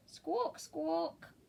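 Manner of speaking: high squawking parrot; high parrot squawks. Acted. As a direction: squawk it like a bird.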